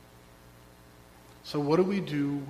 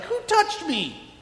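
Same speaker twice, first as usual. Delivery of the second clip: high, slow searching question; startled high cry of who touched me; urgent cry; 13 semitones higher, 4 dB louder, slower than usual. Acted. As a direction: call out over the crowd, high. Jesus's cry in a retold crowd scene.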